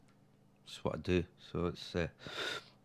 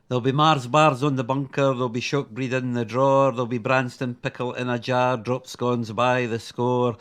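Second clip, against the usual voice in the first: rhythmic poem recital in a Midlothian Scots accent; Midlothian performance voice. Real, not acted. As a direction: perform it as comic verse in broad Midlothian Scots. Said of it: "very much a Midlothian Scottish performance voice".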